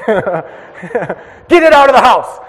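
high, loud, slow mock command; mom's order. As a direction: drag out the order with a laugh. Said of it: "mom orders".

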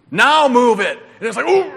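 loud shouted order; coach shouting. Retold or imitated. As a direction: bellow at the sled pushers. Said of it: "takes the two assistants with him".

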